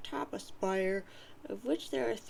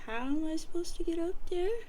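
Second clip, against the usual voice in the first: put-on eager boy asking; 7 semitones higher, 5 dB louder, faster than usual. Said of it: "John says".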